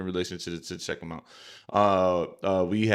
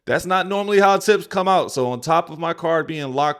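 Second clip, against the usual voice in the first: excited; 7 semitones higher, 6 dB louder than usual. Real, not acted. Reading the customer's update, excited.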